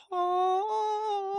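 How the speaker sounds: long, very high held "oh"; imitated high wail. Holds it up in the air, imitated high wail. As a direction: sustain a thin mock wail.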